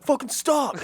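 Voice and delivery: high pitched angry shout; angry yell. Retold or imitated. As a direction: yell it at your brother. Retold sibling fight yelling.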